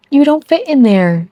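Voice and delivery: high, loud teasing call to the pet; talking to the cat. Real, not acted. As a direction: tell the cat off, playfully and loudly. Scolding the cat in a box.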